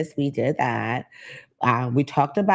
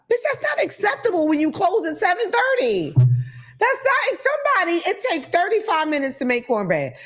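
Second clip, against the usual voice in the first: pitched way up in disbelief, indignant; outraged high rant; 14 semitones higher than usual. Real, not acted. Comic to the lady, outraged high-pitched disbelief. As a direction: squeal the outrage, voice jumping high.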